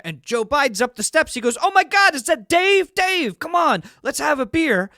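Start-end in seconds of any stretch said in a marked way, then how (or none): 1.6-4.9 high, loud, delighted recognition; excited shout of welcome, pitched way up; thrilled greeting. Acted. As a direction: call out to a favorite buddy. President thrilled to spot Dave.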